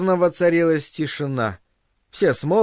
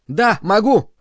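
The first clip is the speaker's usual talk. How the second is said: mild, higher-pitched cry; shouted reply; 6 semitones higher, 3 dB louder than usual. A narrator's reading. Bernard defying the director, mildly shouted. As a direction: call it out defiantly, half-shouted. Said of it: "а крикнул Бернард".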